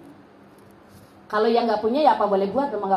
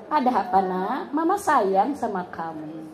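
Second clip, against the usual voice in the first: lower, softer and slow; tender comfort; 6 semitones lower, 4 dB quieter than usual. Acted. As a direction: soothe the upset child gently. Mother comforts child.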